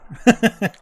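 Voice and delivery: sudden high loud laugh; burst of laughter. Real, not acted. Fan watching the game, bursting into high laughter. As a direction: let out a real burst of laughter.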